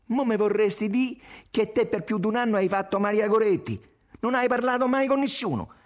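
high, loud jealous accusation; furious shout. A narrator's reading. Tommaso accuses Irene of talking to men, shouting. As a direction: hurl the accusation at full voice. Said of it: "gridò".

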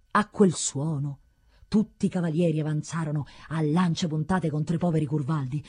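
plain reading voice

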